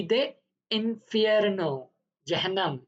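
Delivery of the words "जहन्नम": ordinary talk, nothing marked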